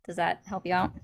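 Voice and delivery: ordinary delivery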